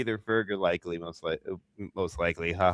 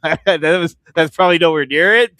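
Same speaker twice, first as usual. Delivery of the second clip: laughing; 8 semitones higher, 7 dB louder than usual. Real, not acted. Laughing at own accent attempt.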